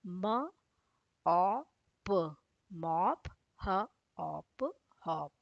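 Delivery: म, अ, प pulled apart very slowly, then मॉप; slow sounding out. Shown as a demonstration. Teacher slowly sounds out short o words for children. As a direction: stretch each sound slowly before blending मॉप and हॉप. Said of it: "ओ का sound है अ".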